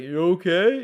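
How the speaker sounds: mock worried check-in; lightly voiced dad asking if he's fine; imitating dad. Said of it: "And my dad's like".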